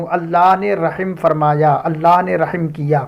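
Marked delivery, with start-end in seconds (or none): none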